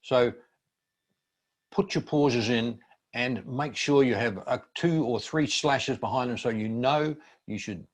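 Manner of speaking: ordinary talk, nothing marked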